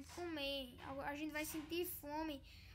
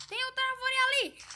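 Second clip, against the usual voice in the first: excited shout; 9 semitones higher, 12 dB louder than usual. Real, not acted. Spotting another tree.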